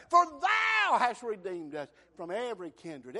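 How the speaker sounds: loud, high preaching shout; full-force preaching. Acted. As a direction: preach it out, ringing to the back pews.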